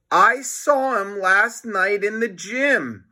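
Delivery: Melvin tattling. Acted. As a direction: snitch enjoying it. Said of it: "Melvin said".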